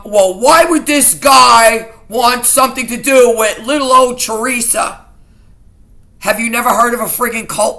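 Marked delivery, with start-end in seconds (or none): 0.0-5.0 mocking the sergeant. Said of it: "That's what this cop said to me".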